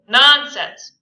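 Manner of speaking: loud scornful dismissal; dismissive. Acted. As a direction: brush it off scornfully. Tom dismissive.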